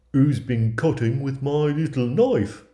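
high, loud dwarf character voice; high-pitched storybook dwarf, demanding to know; indignant dwarf. Acted. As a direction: pipe up loudly as a put-out little dwarf. Sixth dwarf finds his knife used.

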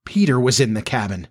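ordinary delivery, nothing marked

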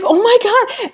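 a high, shocked cry of dismay; panicked oh my God. Retold or imitated. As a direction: gasp it out, high and alarmed, just after the crash. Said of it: "I was like".

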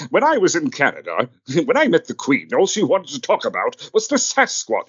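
high, grand theatrical anecdote voice; Brian Blessed impression. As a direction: tell it like a booming old actor. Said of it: "he says".

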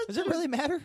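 very high, loud question through laughter; laughing disbelief. Real, not acted. Host teases friend about sausage brand, laughing disbelief. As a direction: squeak it out incredulously while laughing.

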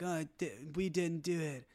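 softly, as a mock last breath; weak, quiet dying regret. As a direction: feebly play someone on their deathbed. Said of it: "laying there dying like".

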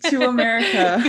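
laughing loud and high through the words; can't stop laughing mid-sentence; giggling while telling it. Real, not acted.

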